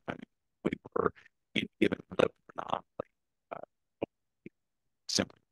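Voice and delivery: choppy audio cutting out; broken-up connection dropping words; corrupted glitchy audio. Real, not acted. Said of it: "your audio is cutting out again".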